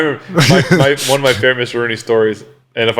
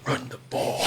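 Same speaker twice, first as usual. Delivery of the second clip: soft, unvoiced whisper of a play call; whispered order; 16 dB quieter, slower than usual. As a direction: hiss it under your breath. Mouthing a play call to Wiz.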